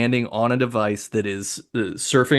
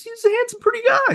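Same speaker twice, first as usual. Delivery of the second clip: much higher, swooning voice; hammed-up fangirl gush, much higher; mock gushing; faster than usual. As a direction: gush over him in a high swoon. Gushing over his looks.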